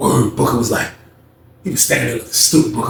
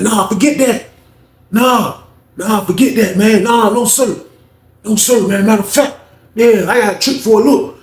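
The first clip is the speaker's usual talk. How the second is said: high, insistent acted refusal; heated refusal; 6 semitones higher than usual. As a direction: act out waving off all objections. Alvin refuses to drop it.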